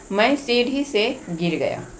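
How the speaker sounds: usual speaking voice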